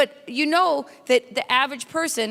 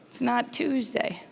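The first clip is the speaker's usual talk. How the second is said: normal talking voice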